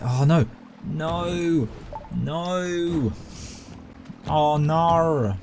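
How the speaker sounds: long, high drawn-out "no"; dismayed wail. Real, not acted.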